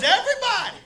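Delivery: high, bright-loud everybody; mock-cheery insistence. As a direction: chirp it like it's obvious. Dude's high exclamation.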